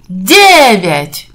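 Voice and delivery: usual speaking voice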